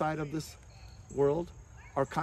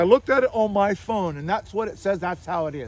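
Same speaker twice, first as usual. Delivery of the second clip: higher, louder mock certainty; mock know-it-all insistence; 4 semitones higher, 8 dB louder than usual. Mimicking kids who claim the truth, emphatic mock certainty. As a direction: imitate someone loudly insisting they know.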